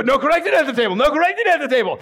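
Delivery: put-on high, increasingly fast childish bickering; mock squabbling. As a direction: trade the same retort, escalating. Siblings' escalating endless loop.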